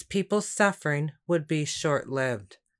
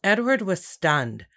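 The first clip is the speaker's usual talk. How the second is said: plain reading voice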